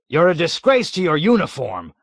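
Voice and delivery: loud, high indignant bluster; blustering wrath. A narrator's reading. Colonel blustering furiously at the captain. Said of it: "declared with blustering wrath".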